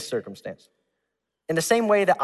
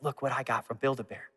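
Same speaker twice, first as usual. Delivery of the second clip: much softer, hushed, sneaky little brag to a sister; secret hushed brag; 3 semitones lower, 9 dB quieter than usual. Retold or imitated. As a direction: murmur it like a kid sharing a secret. Showing sister stolen heart secretly.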